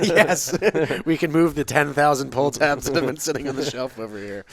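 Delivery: laughing while talking; words bubbling with laughter. Real, not acted. Laughing through the joke.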